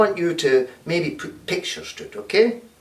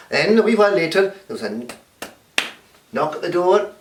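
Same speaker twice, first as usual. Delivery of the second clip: ordinary delivery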